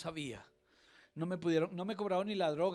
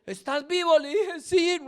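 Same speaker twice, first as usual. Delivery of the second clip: much higher, much louder, crying out in relief; emotional outcry; 14 semitones higher, 16 dB louder than usual. Retold or imitated. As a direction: shout it as if seeing him alive. Wife meets him crying outside.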